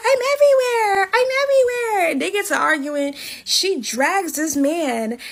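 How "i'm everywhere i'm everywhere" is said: high, loud mock taunt, imitating the angry girl; mocking Kalani. Kalani taunting Drake during the fight, high mocking voice. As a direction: crank the voice way up and taunt him.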